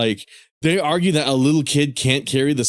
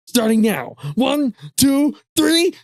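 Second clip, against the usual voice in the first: high, rising count, lips pressed shut; granny counting up then clamming up; high stubborn granny, mouth sealed; old lady voice; 12 semitones higher, much slower than usual. Acted.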